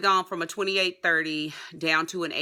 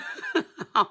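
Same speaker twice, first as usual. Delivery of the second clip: high laugh through tears; teary laugh; 11 semitones higher than usual. Real, not acted. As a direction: laugh off the emotion.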